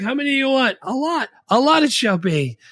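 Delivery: hammed-up movie dialogue; high character voice. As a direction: play the exchange big and silly. Continuing scene voices.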